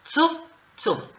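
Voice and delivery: "tsu" high and sharp with a clear t; "tsu" as a sharp affricate; crisp tsu. Shown as a demonstration. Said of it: "puedo decir tsu".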